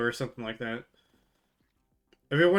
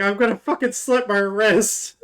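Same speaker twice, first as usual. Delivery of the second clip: loud, laughing rant; laughing outburst; 8 semitones higher, 7 dB louder than usual. Retold or imitated. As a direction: blurt it out laughing. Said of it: "I was like".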